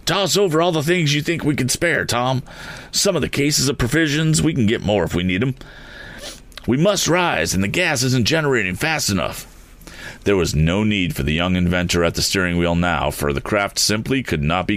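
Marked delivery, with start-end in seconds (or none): 0.0-9.5 much higher, urgent orders; urgent balloonist. A narrator's reading. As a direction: rush the orders. Sharp ordering in crisis.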